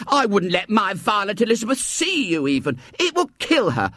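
high, piercing hysterical scream of a woman; hysterical cry. A narrator's reading. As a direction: shriek it out in fright at a horrid child. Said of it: "gave an hysterical scream".

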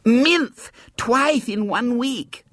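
high, very slow lisping outrage; disgusted little girl. A narrator's reading. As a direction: draw it out in childish disgust. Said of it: "a grimace of disgust".

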